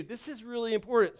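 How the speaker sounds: ordinary talk, nothing marked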